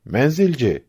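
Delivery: narrated call, not a full yell. A narrator's reading. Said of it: "diye bağırmış".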